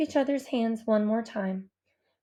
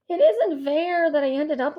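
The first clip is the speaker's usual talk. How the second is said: groan; 4 semitones higher, 5 dB louder than usual. A narrator's reading. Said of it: "I groan".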